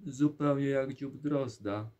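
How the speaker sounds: loud, high, scornful exclamation; mocking princess. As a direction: jeer at the king's crooked chin. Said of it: "a królewna zawołała". (głośna, wysoka, drwiąca królewna)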